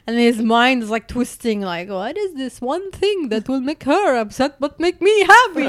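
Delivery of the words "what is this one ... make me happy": puzzled husband. Retold or imitated.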